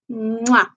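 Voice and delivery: smacked "mwah" kiss sound; blown kiss. Real, not acted. Signing off to viewers, a blown-kiss "mwah". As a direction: blow an audible kiss.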